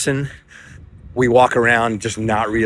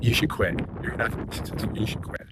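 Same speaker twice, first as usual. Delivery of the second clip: retold teacher's put-down, much softer than usual; hushed discouragement; 8 dB quieter than usual. Teacher tells a boy to give up on school, hushed.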